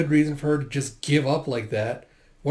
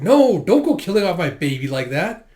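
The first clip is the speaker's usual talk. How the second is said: louder protest, pitched up; indignant father; 4 semitones higher, 7 dB louder than usual. Acted. Father protests to the monk, loud and indignant. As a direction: push back at the monk's blunder.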